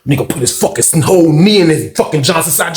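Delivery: ordinary delivery, nothing marked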